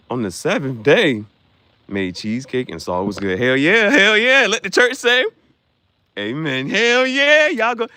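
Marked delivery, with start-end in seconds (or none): 3.4-7.9 much louder, higher preacher hype; rally the church like a preacher; mock church hype. Mock church call and response.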